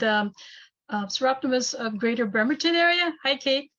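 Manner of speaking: ordinary delivery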